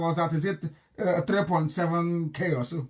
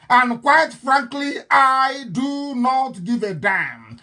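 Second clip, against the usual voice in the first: slow defiant; 4 semitones higher, 4 dB louder, much slower than usual. Real, not acted. Defiant to listeners.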